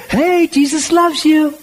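high, slow, gentle "Hey, Jesus loves you."; a hint of tender kindness.

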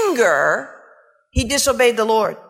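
ordinary talk

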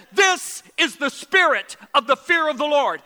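loud, slow, emphatic declaration; preacher's booming pronouncement; bold proclamation. Retold or imitated. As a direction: proclaim it word by word with force. Retold sudden declaration by his mouth.

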